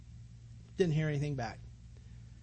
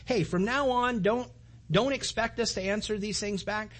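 higher, exasperated venting; steaming rant; 5 semitones higher than usual. Retold or imitated. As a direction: rant at them, fed up. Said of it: "I'm kinda steaming".

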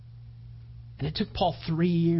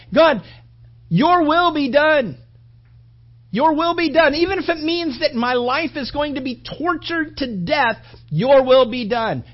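louder, earnest plea to the Father; voicing Jesus praying; 8 semitones higher, 9 dB louder than usual. Jesus praying in the garden, louder earnest plea. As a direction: pray it as Jesus would, surrendering. Jesus's prayer voiced again.

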